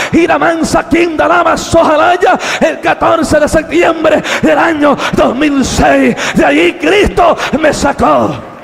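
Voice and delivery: loud, high, driving Pentecostal sermon delivery; full-force preaching. Acted. Preacher recounting his descent into darkness, full-force preaching. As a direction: cry out each phrase at full power.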